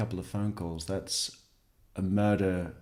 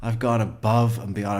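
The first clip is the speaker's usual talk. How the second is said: louder, hammed-up repeat of the boast; mocking repeat; 3 semitones higher, 7 dB louder than usual. Host mocking the suspect's boast, loud and hammed-up. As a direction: throw his phrase back at him, mocking.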